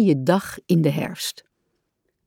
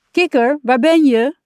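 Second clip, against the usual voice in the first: Rat calls out, higher and louder; calling out; 7 semitones higher, 5 dB louder than usual. A narrator's reading. Rat searches the woods for Frog, calling loudly. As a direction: shout into the woods for a missing friend. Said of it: "roept hij".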